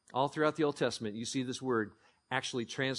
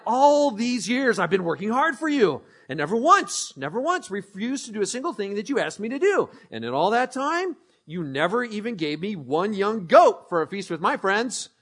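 raised, higher indignant protest; aggrieved complaint; 7 semitones higher, 9 dB louder than usual. Acted. Older brother reproaching his father, loud aggrieved protest. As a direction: let the resentment pour out.

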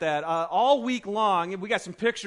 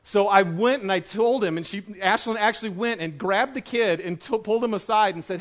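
ordinary talk, nothing marked

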